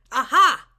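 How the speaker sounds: very loud exclamation; sudden loud cry of recognition; triumphant discovery. A narrator's reading. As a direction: pounce on it loudly, culprit found. Principal discovers whose dog it is.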